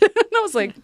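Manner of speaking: ordinary talk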